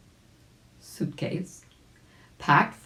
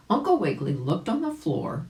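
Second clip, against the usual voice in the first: ordinary delivery, nothing marked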